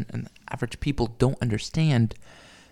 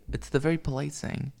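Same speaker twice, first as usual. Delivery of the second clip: ordinary talk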